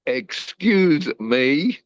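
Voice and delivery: high, slow, drawn-out "excuse me?"; stretched-out, scandalised question; shocked disbelief. Retold or imitated. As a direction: act floored by what he just said. Incredulous reply to brother.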